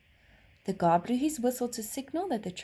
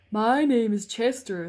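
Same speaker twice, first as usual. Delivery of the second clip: horse voice; 2 semitones higher, 7 dB louder than usual. A narrator's reading. Horse character voice.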